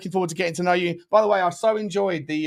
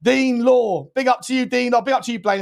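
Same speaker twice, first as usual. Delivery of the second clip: higher, louder hype shoutout; excited shoutout; 7 semitones higher, 3 dB louder than usual. Real, not acted. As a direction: hype up the donor on stream. Shoutout to superchat donor.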